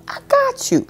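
high-pitched playful repeat; high squeal. Acted. Repeat after whisper, high.

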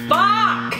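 sharp, high, angry outburst; frustrated shout. Real, not acted. Losing the guessing game.

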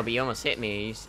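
ordinary delivery

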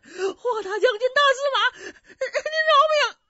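high, loud pleading wail; frantic begging. Acted. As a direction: beg for your life in a crying shriek. 跪地求饶. (尖声哭喊着求饶)

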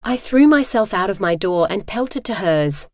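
ordinary delivery, nothing marked

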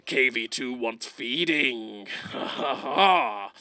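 low, slow mock French voice; mock French accent. The gamer voicing his hungry KV-2 hunting the Nashorn, mock French accent. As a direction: play a hungry tank in a hammed-up French accent. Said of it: "he turned French for a minute there".

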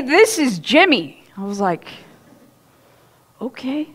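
ordinary talk, nothing marked

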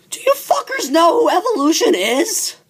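high, outraged yell at the crowd; exasperated. Real, not acted. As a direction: demand it in disbelief. Yelling at crowd.

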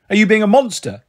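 usual speaking voice

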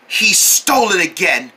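normal talking voice

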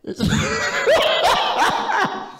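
sudden high-pitched cackle; squealing laugh. Real, not acted. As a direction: break up laughing at his own line. Laughing at own joke.

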